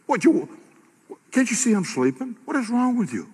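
high, exasperated rebuke; angry Jesus. Acted. Preacher voicing Jesus woken in the boat, high and exasperated. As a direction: scold the sleepy disciples.

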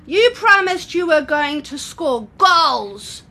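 put-on high, slow child's whine; bored little boy. Raoul complains to Neo and Priya at halftime. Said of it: "Raoul told Neo and Priya".

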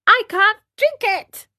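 put-on high-pitched childish refusal; balking boy, pitched well up; whiny puppet boy. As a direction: play a fussy little marionette protesting.

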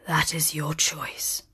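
soft sorrowful whisper; sad. Acted. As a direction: say it sadly, barely voiced. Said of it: "said the beast sadly".

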